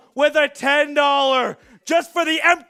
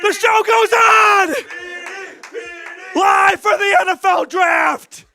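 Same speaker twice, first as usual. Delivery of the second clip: shouting; 6 semitones higher, 5 dB louder than usual. Real, not acted. Celebrating a comeback.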